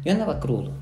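openly admitting the hangover when pushed; said out loud. Real, not acted. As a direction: say it out loud this time, no hiding. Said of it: "No, dilo, dilo".

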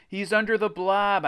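high, mildly screamed warning; screamed alarm. A narrator's reading. Narrator warns Jack about Greg, screamed alarm. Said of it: "I screamed to Jack".

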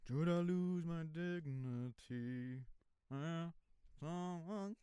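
slow, soft, drawn-out theatrical plea; sing-speak the line softly and slowly; show line. Retold or imitated. Quoting his musical role, a slow soft plea. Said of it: "the dude who goes".

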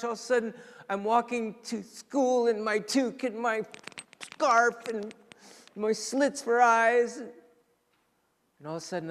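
ordinary talk

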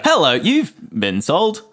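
slow, chirpy robocall greeting; phone menu voice. Pretend. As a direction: sales recording voice. Acting out automated sales call.

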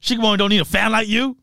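high mocking put-down; scornful put-down. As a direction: scorn the fake fan. Scolding fake fan.

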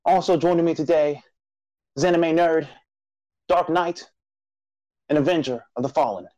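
rally the nation like a solemn show herald; grand announcer intro. Acted.

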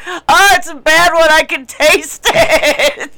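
high, loud, slow disgusted complaint; grossed-out whine. Retold or imitated. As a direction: drag it out like the smell is unbearable. Retold disgust at farm smell.

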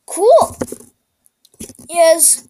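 high, loud cry of delight; excited shout. Acted. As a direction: blurt it out big and bright. Kid exclaims over the sky.

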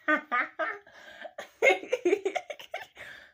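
loud, high giggle; high burst of laughter. Real, not acted. Admitting she flirts, with a high burst of laughter.

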